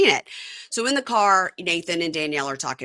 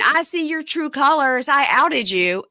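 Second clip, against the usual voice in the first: higher, slow mock yell; retold scream; 7 semitones higher, 4 dB louder, slower than usual. Retelling Jen screaming at Danielle, mock yell. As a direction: imitate a screamed accusation, only half full force. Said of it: "And Jen screaming".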